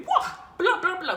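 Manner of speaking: high, loud burst of wow; gleefully exaggerated, with a laugh; exuberant boast. Real, not acted. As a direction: burst out, piling up the repeated word. Boasting of many male friends.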